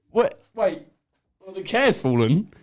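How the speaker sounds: usual speaking voice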